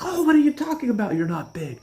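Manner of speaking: high, fast reassuring squeal; mocking Stevie. Stevie reassuring the narrator, high fast mock voice. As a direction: rush it high and chummy. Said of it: "And he's like".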